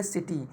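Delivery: City with टी drawn out; light सि, stretched टी in city; stress on टी. Shown as a demonstration. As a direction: lean on the टी of city. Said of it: "टी को हमने खींचा है".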